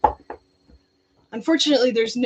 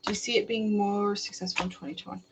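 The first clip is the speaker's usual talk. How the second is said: ordinary delivery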